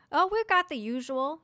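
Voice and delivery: cheerful innkeeper welcoming a guest; friendly bartender. Acted. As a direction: greet the customer with a grin. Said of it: "smiles at Missouri and says".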